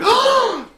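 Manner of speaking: very high, loud shriek; panicked scream. Acted. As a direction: scream like a terrified bystander. Onlookers panic at killing.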